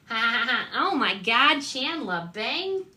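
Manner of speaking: playful Jersey-accent impression of a sitcom girlfriend; Janice's Jersey accent. Vlogger answering chat with a Jersey-accent impression. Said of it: "isn't Janice from Friends a little bit of a Jersey accent".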